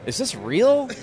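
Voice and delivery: high, lightly voiced wife asking; a hint of the wife's disbelieving question; imitating his wife. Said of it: "she was like".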